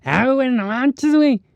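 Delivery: put-on an Argentine putting on a Mexican accent; fake Mexican slang accent. Argentine visitor greeting you, hammed-up fake Mexican accent. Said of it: "que te llega hablando".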